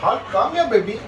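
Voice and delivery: high rising call to the dog. Real, not acted. Owner calling Hulk over in a high, sweet voice.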